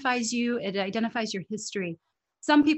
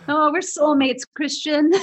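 louder and higher, laughing as he speaks; laughing delight; 7 semitones higher, 8 dB louder than usual. Real, not acted. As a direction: burst out laughing with joy at the connection. Delighted agreement with guest.

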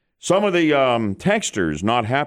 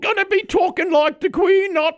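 very high posh royal voice, held all through; Queen impression. Host teasing co-hosts as the Queen, in a very high royal voice. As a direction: lift it way up into the Queen's voice. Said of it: "I thought the Queen was coming back".

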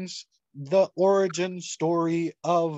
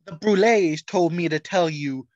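ordinary delivery

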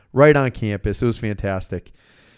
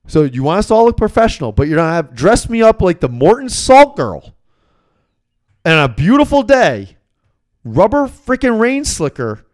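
higher, louder, heated complaint; fired-up rant; 6 semitones higher, 3 dB louder than usual. Retold or imitated. Young usher ranting at the boss about the slicker, fired up. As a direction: rattle it off all fired up at the boss. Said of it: "I get all fired up".

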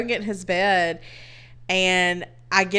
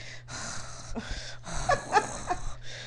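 long whispered sigh of dread; 12 semitones higher, 11 dB quieter than usual. Retold or imitated. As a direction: exhale like you want to disappear. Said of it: "the whole time I was like".

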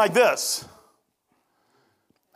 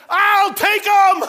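high, loud, drawn-out nurse voice through a laugh; eager nurse; 11 semitones higher, 9 dB louder, much slower than usual. Retold or imitated. Preacher imitating the nurse claiming the tracts, laughing and loud. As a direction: grab the offer, laughing. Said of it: "And the nurse says".

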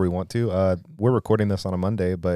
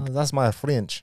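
higher, slow, drawn-out fake French accent; bad French accent; 4 semitones higher, slower than usual. Co-host showing off a terrible French accent. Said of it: "It's a terrible French accent".

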